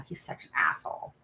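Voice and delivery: hushed whisper, an aside to a friend; whispered insult. Retold or imitated. Daughter whispers to her friend about the assistant principal. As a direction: breathe it out so he can't hear.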